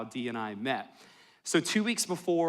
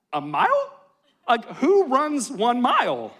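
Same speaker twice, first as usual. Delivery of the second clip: higher, louder, incredulous "A mile?"; comic disbelief; 8 semitones higher, 6 dB louder than usual. Real, not acted. Pastor to congregation, mock outrage at running, louder and higher. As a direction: sell the outrage as a joke.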